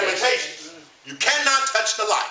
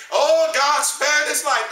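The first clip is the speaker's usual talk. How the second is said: raised, higher joyful church shout, drawn out slowly; shouting the victory; 5 semitones higher, slower than usual. Retold or imitated. Churchgoer praising God for sparing his life, joyful shout. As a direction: proclaim it like a triumphant praise report. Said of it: "we shouting the victory".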